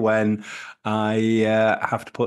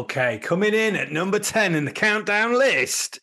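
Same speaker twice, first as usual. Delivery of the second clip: countdown host; 8 semitones higher than usual. Pretend.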